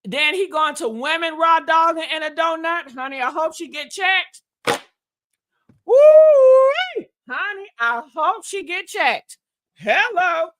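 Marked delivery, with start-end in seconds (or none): 5.9-7.1 drawn-out high "woo-wee"; loud high whoop. Real, not acted. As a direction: let out a big scandalized holler. Whoop at the gossip.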